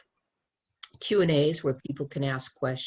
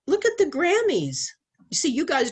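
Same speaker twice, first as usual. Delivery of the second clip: indignant outburst; 12 semitones higher, 4 dB louder than usual. Real, not acted. Outraged outburst about Grammys.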